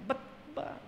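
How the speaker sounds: high, hushed reproach; mock scolding. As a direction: act out a parent starting to scold a child. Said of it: "Pinapagalitan natin yung ano, yung bata".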